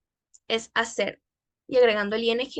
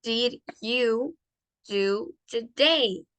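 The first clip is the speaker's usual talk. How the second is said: very slow, word by word; careful model pronunciation; much slower than usual. Shown as a demonstration. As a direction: spell it out slowly for learners, pausing between words. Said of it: "escuchen bien".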